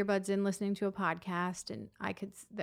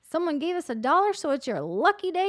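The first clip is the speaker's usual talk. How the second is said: put-on high, louder, proud child voice; little boy voice; 7 semitones higher, 5 dB louder than usual. Boy telling the walker it's her lucky day, high eager voice. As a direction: announce it like a proud little kid. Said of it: "the one little boy goes".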